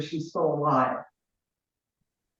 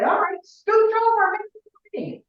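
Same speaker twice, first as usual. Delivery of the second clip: much higher, louder, bossy call; stepfather's advice, voicing a bold loud entrance; pushy barging in; 5 dB louder than usual. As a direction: push your way in and make room. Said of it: "Mark said".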